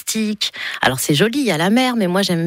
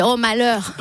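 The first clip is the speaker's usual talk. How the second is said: Niçois accent bursting out, higher pitch; southern French accent; 4 semitones higher, slower than usual. Shown as a demonstration. Singer showing host her angry accent, southern French accent. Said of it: "quand je suis énervée ... ça part direct".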